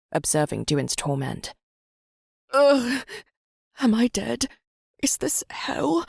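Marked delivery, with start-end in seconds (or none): 2.5-4.5 high, pained, terrified; desperate gasping. Acted. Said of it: "Duin gasped desperately". 5.0-6.1 terrified whisper. Acted. Terrified in lava pit.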